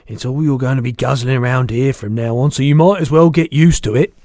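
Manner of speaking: loud, emphatic giant character; insistent BFG. A narrator's reading. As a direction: press the point loudly as a giant.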